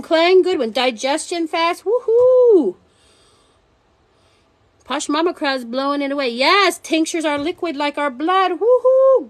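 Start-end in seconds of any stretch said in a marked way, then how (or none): 1.8-2.8 high, loud woohoo; excited cheer. Real, not acted. Cheering viewers' answers in the live chat, excited woohoo. 8.6-9.3 high, loud woohoo; excited cheer. Real, not acted. Cheering viewers' answers in the live chat, excited woohoo.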